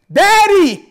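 put-on high, loud child's call; child calling Daddy. Preacher voicing a child calling his father, high and loud. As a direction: cry out like a child in need. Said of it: "whenever your child needs something".

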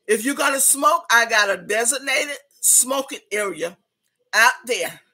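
ordinary delivery

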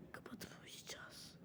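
breathy bedtime whisper. Acted. Kids in bed whisper goodnight to each other, softly. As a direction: hush it like the lights are out. Going to sleep, lights off.

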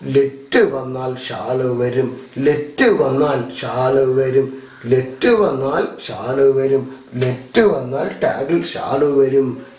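slow, repetitive rote drill; memorisation drill. Shown as a demonstration. Teacher drilling the let-shall rule for students, slow rote recitation. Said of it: "പഠിച്ചു വെക്കേണ്ടത് എങ്ങനെയാ".

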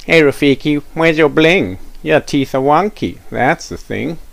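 high, loud playground jeer; taunting kid. Acted. Cool kid mocking Rafiki's wonky teeth, high loud jeer. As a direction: sing the insult at him.

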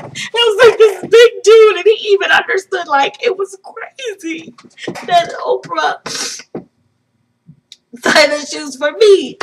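high, tearful and overwhelmed; choked up. Real, not acted. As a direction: squeeze it out through happy tears. Choking up, retelling the moving moment.

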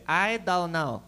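lightly put-on Chinese accent, flat and evasive; mock Chinese accent. Friends stonewalling the cops, light mock Chinese accent. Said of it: "then they turned Chinese".

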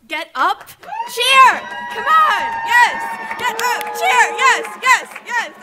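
cheering shout at the crowd. Real, not acted. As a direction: hype the crowd up, yelling.

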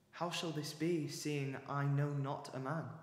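usual speaking voice

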